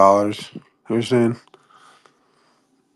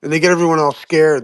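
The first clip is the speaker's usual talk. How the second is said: ordinary delivery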